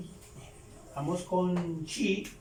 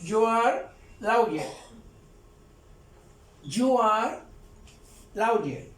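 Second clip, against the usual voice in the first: teacher modelling You are lawyer slowly; slow model; slower than usual. Shown as a demonstration. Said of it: "Ahí estoy diciendo".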